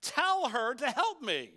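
loud, bossy order to the Lord; bossy demand. Retold or imitated. Martha ordering Jesus, loud and bossy. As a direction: demand it like you're the one in charge. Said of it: "This is the command".

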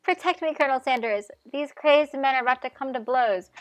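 bat your eyelashes with your voice; coy damsel voice. Acted. Ashley begging Colonel Sanders for protection, coy flirty plea. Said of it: "Ashley's tone has completely changed".

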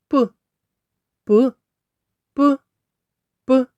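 short "П" repeated with gaps; isolated first sound. Shown as a demonstration. Teacher isolating the first sound, short separate "п". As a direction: pop a lone "п", pausing between repeats. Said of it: "Перший звук у слові".

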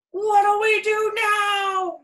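high, loud, very slow cry; frightened pirate yelling; panicked yell. A narrator's reading. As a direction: cry out in alarm at the split mast. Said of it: "yelled one of the pirates".

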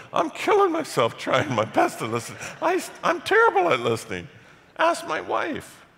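squeaky high, loud laughing protest; exasperated retort. Retold or imitated. Pastor telling the man he is terrible at listening, high, loud and laughing. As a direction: climb up high in mock despair and laugh it out. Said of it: "And I'm going".

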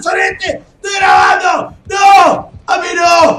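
high, loud panicked shouting; real screaming panic; frantic yelling. Real, not acted. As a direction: yell in panic as you're chased. Screaming while attacked.

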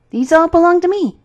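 high, loud and bossy; possessive robber girl. A narrator's reading. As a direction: claim them loudly, like a bossy child. Robber girl boasting of her birds.

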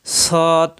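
সৎ with a long hissed s; whistling sibilant. Shown as a demonstration. Teacher pointing out the escaping air, whistling sibilant. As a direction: let the air escape audibly on সৎ. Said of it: "বাতাস বের হচ্ছে".

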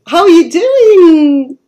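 high, loud, thrilled welcome; over the moon that the guest link worked; excited. Real, not acted. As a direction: burst out with delight as the guest appears. Excitedly greeting the new guest.

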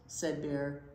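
plain reading voice

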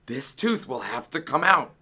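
mild professional announcement; announcing. A narrator's reading. Dentist giving the fox his verdict, announced. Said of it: "Doctor DeSoto announced".

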